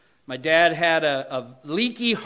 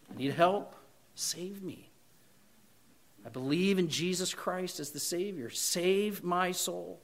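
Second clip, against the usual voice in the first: quiet prayer; 10 dB quieter than usual. Shown as a demonstration. Demonstrated prayer after crying help.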